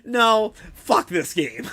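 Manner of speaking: imitating a gamer who snaps and quits; fed-up outburst. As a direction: quit the game in a huff. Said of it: "you're like".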